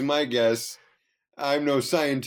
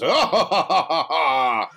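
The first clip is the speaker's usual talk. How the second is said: slow, louder, hammed-up horror-host cackle; mock sinister laughing sign-off; Vincent Price laugh; 5 dB louder, slower than usual. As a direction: laugh like the end of a spooky movie. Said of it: "Vincent Price goes".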